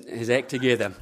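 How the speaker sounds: normal talking voice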